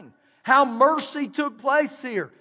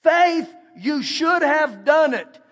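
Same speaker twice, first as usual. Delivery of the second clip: "Faith," called out louder and higher; emphatic reading; 3 semitones higher, 4 dB louder, slower than usual. Shown as a demonstration. Preacher rereading the verse, punching the word "Faith". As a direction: punch "Faith," then slow on "you should have done it.". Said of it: "read it in this sense".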